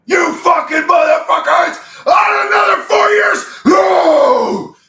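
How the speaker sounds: high, loud, drawn-out screaming fury; screaming crowd. Pretend. Streamer voicing a furious crowd, high loud screaming. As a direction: scream it like an outraged mob watching the video.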